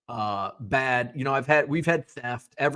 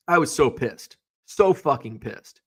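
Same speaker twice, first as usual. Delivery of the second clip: ordinary talk, nothing marked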